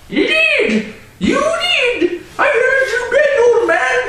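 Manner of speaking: put-on high, loud child's shout; cried out. Little child accusing the drunk owner, shouting. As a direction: yell it like an outraged little kid. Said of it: "None cried out".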